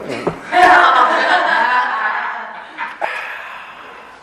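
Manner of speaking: a long, high laugh that rolls on and fades. Real, not acted. Laughing at his wife's kind excuse for him. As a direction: let the laugh run out.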